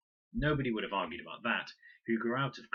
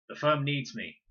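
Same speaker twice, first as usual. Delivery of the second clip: father's voice; 6 semitones higher, 7 dB louder than usual. A narrator's reading.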